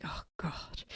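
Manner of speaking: breathy, no voice at all, just air; voiceless whisper. Retold or imitated. Telling listeners how she had lost her voice, voiceless whisper. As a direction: mouth it with only breath, voice gone. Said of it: "Like, nothing came out".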